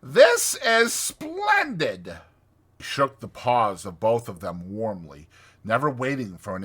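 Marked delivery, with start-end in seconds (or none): 0.0-2.2 slow, loud, high delight; delighted cry. A narrator's reading. Toad jumps up on seeing friends, delighted cry. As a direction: exclaim it, stretching each word. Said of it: "he cried, jumping up on seeing them".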